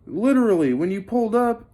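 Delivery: retold outrage. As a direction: let the indignation climb as you list the wrongs. Retold angry complaint to chief.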